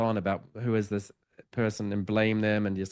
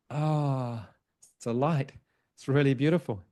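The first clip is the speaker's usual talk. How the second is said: higher, mildly awed retelling; gentle awe; 3 semitones higher than usual. Retold or imitated. Spirit looking up and discovering the light, mildly awed. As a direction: voice a spirit's dawning wonder.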